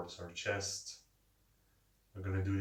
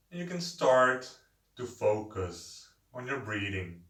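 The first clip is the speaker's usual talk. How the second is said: guided meditation. Acted. Leads a seated breathing meditation.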